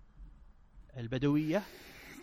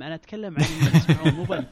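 laughing while talking; words through real laughter; 10 dB louder, faster than usual. Real, not acted. Teasing reply, laughing.

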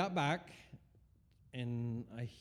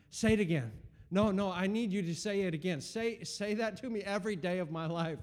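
louder, higher urgent plea; eager plea; 6 semitones higher, 4 dB louder than usual. Retold or imitated. Man in shower urging God to repeat promise, louder and eager. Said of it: "I just literally said out of my mouth".

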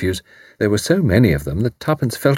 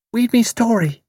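put-on toddler voice; 14 semitones higher than usual. A narrator's reading. Toddler Betty demands.

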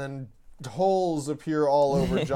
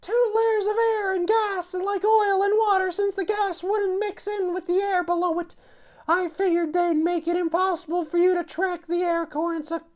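put-on high, confident young woman; Jolene voice; 4 dB louder than usual. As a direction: explain the plan with smug confidence.

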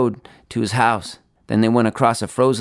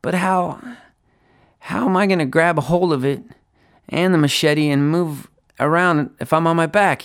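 put-on higher, halting worried boy; mild stammering; 7 semitones higher than usual. A narrator's reading. Charlie questioning Lonnie about the machete, mildly stammering. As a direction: falter between phrases with a touch of panic. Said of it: "he stammered".

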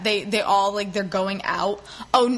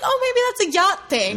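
high mock wonder; 10 semitones higher than usual. Mock naive musing.

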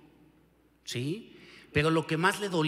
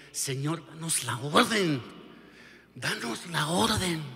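louder, urgent plea of warrior angels; eager angels; 2 semitones higher, 3 dB louder than usual. Acted. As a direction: beg for permission to strike. Angels begging God to act.

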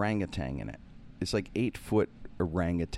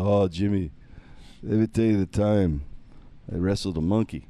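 louder, lightly voiced storytelling wrestler; Bruno; 5 dB louder than usual. Wrestler confiding his monkey tale to Jimmy, lightly imitated. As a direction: imitate an old friend launching into his tale. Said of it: "he looks at me and goes".

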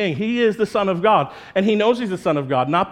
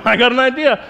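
higher and louder, pleased with the plan; eager dude; 5 semitones higher, 5 dB louder than usual. Retold or imitated. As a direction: pitch it proudly. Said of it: "these guys going".

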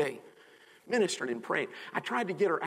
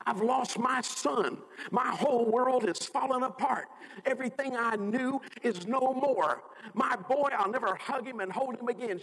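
high, anguished imitation of a grieving mother; retold grief; 9 semitones higher than usual. Retold mother grieving her son.

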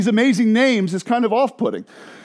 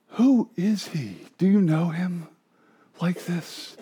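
ordinary talk, nothing marked

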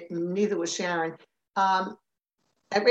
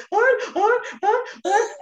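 high, loud mock sobs; imitated crying; 13 semitones higher, 4 dB louder, slower than usual. Crying daughter on the phone to mother, mock sobbing. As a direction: blubber it, too choked up to talk. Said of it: "I'm crying, I'm crying".

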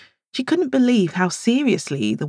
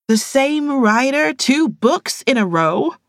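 higher, slow, disbelieving outcry; incredulous friend; indignant exclamation; 10 semitones higher, 4 dB louder, slower than usual. Acted. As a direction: protest in disbelief. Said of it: "Latoya exclaimed".

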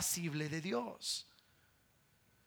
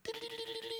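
high, soft tinkling bell sound; little dinner bell jingle; bell imitation; 8 dB quieter, much faster than usual. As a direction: ring it like a tiny bell with your voice. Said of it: "es chiquita y dice".

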